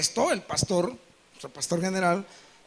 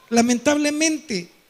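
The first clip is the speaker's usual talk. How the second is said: normal talking voice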